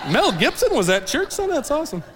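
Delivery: high-pitched, gullible mock amazement; amazed. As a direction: imitate an impressed, starstruck church member. Imitating gullible, impressed attendance readers.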